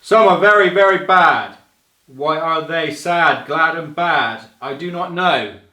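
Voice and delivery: loud, high, booming idiot voice; booming loud read of a children's rhyme; big booming voice. Shown as a demonstration. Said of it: "big booming, loud idiot kind of voice".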